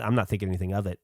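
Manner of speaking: usual speaking voice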